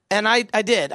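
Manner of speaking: normal talking voice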